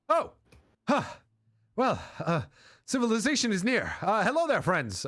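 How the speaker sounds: high-pitched, hailing stranger; hearty traveller. Acted. Traveller greets the party out of the forest, high and hearty. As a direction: call out high to newfound company. GM voices a newly met traveller.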